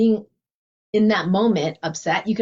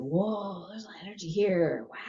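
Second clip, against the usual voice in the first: soft noticing; 9 dB quieter than usual. Retold or imitated. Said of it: "I just notice it".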